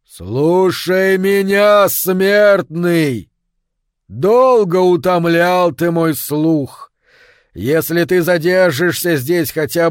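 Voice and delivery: slow, loud, booming spirit voice; thunderous booming voice. A narrator's reading. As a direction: roar it out like a rockslide. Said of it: "голос его был ужасен и оглушителен, как камнепад".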